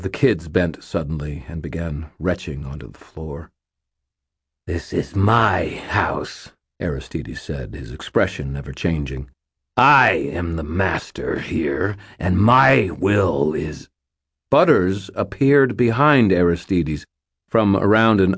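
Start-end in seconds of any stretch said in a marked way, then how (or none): 4.7-6.5 menacing sorcerer. Acted. Sorcerer threatening captive. 9.8-13.9 menacing sorcerer. Acted. Sorcerer declaring dominance.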